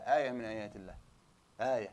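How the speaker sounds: ordinary talk, nothing marked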